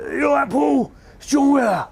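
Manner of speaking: high, strained voice, as if the throat took a blow; pinched high manager voice with a shout in it; John Weller's rough voice. Retold or imitated. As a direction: impersonate the battered ex-boxer, pitched high. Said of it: "'cause he talks like that".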